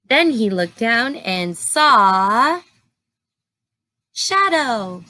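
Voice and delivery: ordinary delivery, nothing marked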